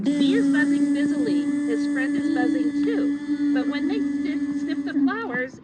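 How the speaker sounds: slow, loud storybook read; reading to children. A narrator's reading. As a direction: read it big and slow for kids. Reading animal book, slow and loud.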